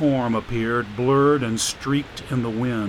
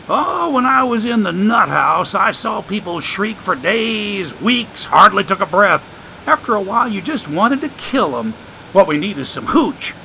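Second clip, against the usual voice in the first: put-on higher, sly voice of an old drifter; streetwise homeless man; 6 semitones higher, 3 dB louder than usual. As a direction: play the wisecracking drifter, amused. Joshua's voice in the story.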